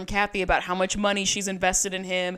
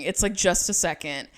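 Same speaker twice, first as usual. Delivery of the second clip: ordinary delivery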